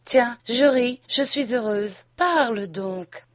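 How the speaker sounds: light burst of happiness, then urge them on; joie jouée. A narrator's reading. Amante réjouie, scène jouée. (joie amoureuse, légèrement exaltée)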